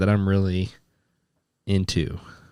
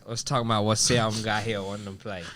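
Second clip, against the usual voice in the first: attempted Atlanta accent; 3 semitones lower, 11 dB quieter than usual. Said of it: "talk like her".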